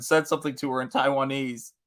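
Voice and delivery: usual speaking voice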